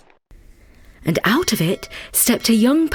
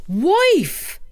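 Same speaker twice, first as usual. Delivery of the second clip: second call, much higher; louder calling; 10 semitones higher than usual. Acted. Takitori calling his wife again, much higher. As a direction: cry out for her. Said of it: "cried Takitori".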